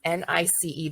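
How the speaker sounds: N I C E spelt letter by letter; spelling out. Shown as a demonstration. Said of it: "is gonna be".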